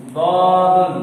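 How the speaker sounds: "दादुन" chanted with a heavy D and rounded first vowel; thick D. Shown as a demonstration. Teacher showing this letter too is thick, heavy chanted "दादुन". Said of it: "ये भी मोटा पढ़ा जाएगा।".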